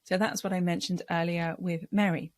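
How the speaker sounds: ordinary delivery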